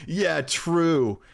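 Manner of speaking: mock your own laughing chime-in; imitating own laughter. Cringing at own home video, imitated goofy laugh. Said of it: "hear yourself go like".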